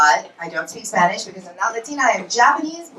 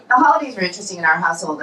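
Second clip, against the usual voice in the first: normal talking voice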